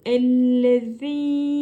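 Ellezine slow with a lisped Z. Shown as a demonstration. Teacher showing ellezine slowly. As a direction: draw ellezine out, tongue on teeth for Z. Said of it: "uzattık V'yi".

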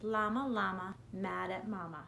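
usual speaking voice

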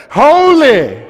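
ordinary talk, nothing marked

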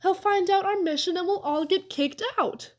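high, anxious protest; worried, high-pitched objection; urgent whisper. A narrator's reading. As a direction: plead it in a high, hushed-urgent voice. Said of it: "I whispered".

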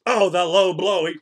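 ordinary delivery

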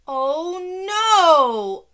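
loud, high panicked wail; upset holler. A narrator's reading. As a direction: holler it in dismay, drawn out. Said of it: "I hollered real upset".

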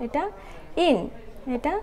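usual speaking voice

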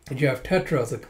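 ordinary delivery

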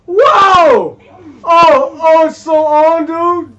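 big high shout of outrage; shocked yelling. Real, not acted. Gamer yelling at a cheap boss, real outrage. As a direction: explode at the screen, loud and high. Enemy cheats, gamer erupts.

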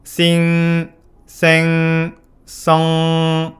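싱, 생, 성, each drawn out into a nasal ng hum; held NG ending. Shown as a demonstration. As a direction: sustain the final ng of 싱, 생, 성 on one level note. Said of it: "영어 단어 뒤에 엔지가 붙게 되면".

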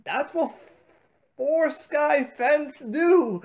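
ordinary talk

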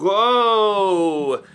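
high-pitched whoa at the new camera view; surprised whoa. Real, not acted. Vlogger sees other camera view, high surprised whoa.

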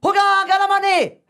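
high, loud mock chief's call; hammed-up chief's shout. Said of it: "Dit le grand chef".

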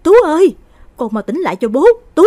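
desperate high wailing cry to his son; a father screaming his boy's name, voice cracking; gào khóc. Acted. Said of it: "mà gào gú". (người cha gào gọi con trai trong tuyệt vọng)

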